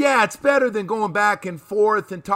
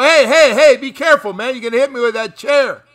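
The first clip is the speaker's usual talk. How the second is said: loud, higher yelling to watch out; hey, dodge that flying chair, louder; mock panicked player; 8 semitones higher, 6 dB louder than usual. Player yelling at Stottlemyre to be careful with the chair, panicked. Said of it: "There goes the chair".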